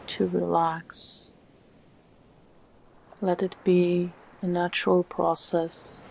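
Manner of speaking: calm meditation voice with long, unhurried pauses; soothing, evenly paced relaxation guidance; guided meditation. Acted. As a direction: guide the listener gently into stillness, phrase by phrase.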